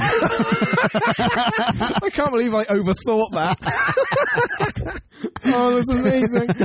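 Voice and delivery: slow, giggling through the words; laughing. Real, not acted. Co-host laughing at his own overthinking. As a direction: laugh at yourself while you say it.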